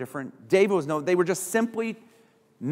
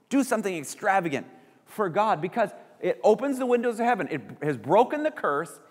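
ordinary delivery

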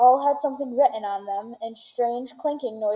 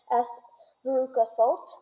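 ordinary delivery, nothing marked

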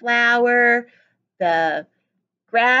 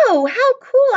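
high, louder gush of delight; mock delight; 10 semitones higher, 5 dB louder than usual. Teacher praises a pupil's wagon, high and louder. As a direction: play up the excitement for kids.

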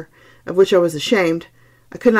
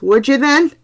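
loud, high Irish challenge; Irish cry; 8 semitones higher, 5 dB louder than usual. A narrator's reading. Irish doctor seizing the fleeing man, crying out in Irish accent. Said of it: "he cried in his unmistakable".

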